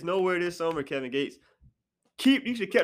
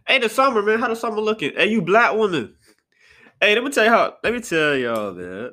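loud, higher hail to the listeners; raised, hollering address; calling out; 4 semitones higher, 7 dB louder than usual. Real, not acted. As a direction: burst out loudly to grab attention. Suddenly hails the audience loudly.

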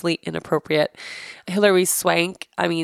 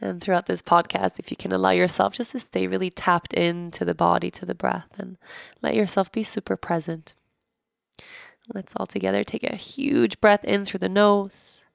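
guided meditation; 3 dB quieter than usual. Acted. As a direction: gently guide listeners inward.

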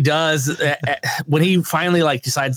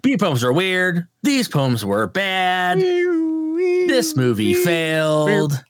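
slow beat poet chant, rising high at the end; beat poem delivery; 4 semitones higher, much slower than usual. Shown as a demonstration. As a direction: drag it out like a beatnik reading. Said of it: "I said it in beat poem".

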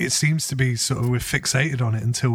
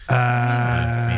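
ordinary talk

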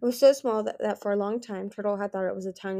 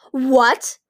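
loud, higher outburst of disbelief; shocked cry; 5 semitones higher, 12 dB louder than usual. A narrator's reading. Fearless learning of Moon's secret powers, shocked cry. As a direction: cry out, stunned. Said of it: "Fearless cried".

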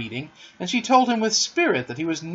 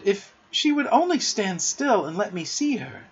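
higher-pitched wistful eagerness; crying out; 6 semitones higher than usual. A narrator's reading. Wendy longing to see the darting fairy, eager cry. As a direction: wish it aloud, eagerly. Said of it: "she cried".

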